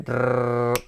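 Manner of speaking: drawn-out rolled R; long tongue trill. Real, not acted. Teacher hunting for the word probability, long rolled trill. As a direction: hold a rolling "rrr". Noise while searching for word.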